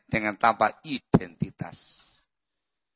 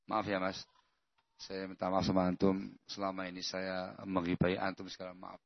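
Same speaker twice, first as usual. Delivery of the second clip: humble apology; 5 semitones lower, 13 dB quieter than usual. Shown as a demonstration. Modelled sincere apology to friend.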